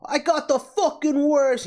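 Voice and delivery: "fucking" stretched high and loud in a put-on Brooklyn accent; mock Brooklyn accent. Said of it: "that Brooklyn accent sort of thing".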